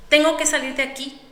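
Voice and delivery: high, loud panicked cry; desperate urge. Acted. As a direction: force it out in desperation. Mujer desesperada por escapar. (grito desesperado por escapar)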